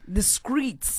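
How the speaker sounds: "streets" said as "screets"; street pronunciation. Shown as a demonstration. Co-host echoing the street word, "streets" as "screets". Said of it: "That's how they say it on the streets".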